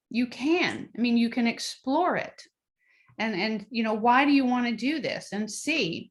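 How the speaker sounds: ordinary talk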